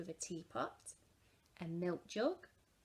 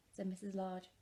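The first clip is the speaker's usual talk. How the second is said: usual speaking voice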